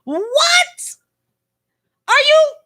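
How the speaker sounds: high, stunned what; shocked what. Real, not acted. Vlogger reacting to shocking news, stunned. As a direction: react in disbelief.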